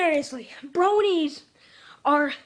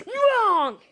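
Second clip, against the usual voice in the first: loud exclamation; 6 semitones higher, 3 dB louder than usual. Real, not acted. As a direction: shout the word high. Kid rants, rhetorical answer.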